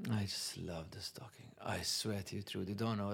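hushed, near-whisper, choked up with feeling; softly, moved almost to tears over the stocking; getting emotional. Real, not acted. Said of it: "I don't know why I'm getting emotional".

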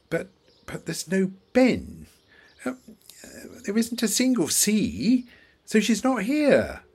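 stammering, then rising to high, loud protest; mounting distress. Acted. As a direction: build from confusion to desperate, high-pitched shouting. Searches list, cannot find his daughter.

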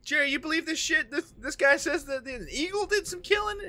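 high-pitched, disbelieving lawman; incredulous lawman. Acted. Lawman asking his partner about an eagle story, incredulous. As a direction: scoff to your partner in a high put-on voice.

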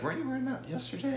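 high, fast teasing classmate voice; mocking classmate. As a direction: squeak it out like a snarky classmate. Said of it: "they're gonna be like".

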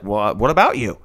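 low, offhand mock reply; imitated indifferent boyfriend. Climber brushing off girlfriend's question, indifferent imitation. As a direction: toss it back low, unbothered. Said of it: "He's like".

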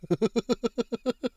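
high giggle at himself; laughing at his own bullhorn bit; amused laugh. Real, not acted. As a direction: break up laughing. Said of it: "Oh my goodness".

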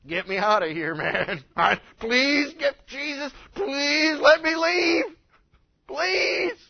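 acted plea climbing to a high, loud wail; desperate begging. Man begging Jesus to let him leave, desperate pleading. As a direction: beg loudly, dragging out each please. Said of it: "He begged Jesus to leave".

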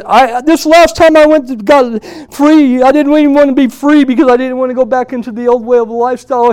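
high, loud outpouring; imitating an overwhelmed man's cry; yelling. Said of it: "he started yelling out as loud as he could".

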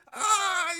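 very high, loud freak-out yell; hammed-up startled shriek; sudden panicked cry; mock scream. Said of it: "You freak out again".

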